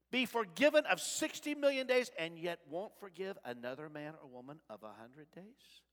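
usual speaking voice

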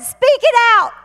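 high, loud urging cry; commanding shout. Retold or imitated. Holy Spirit urges the frightened woman, commanding shout. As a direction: belt out the command with urgency. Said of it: "the Holy Spirit on the inside said".

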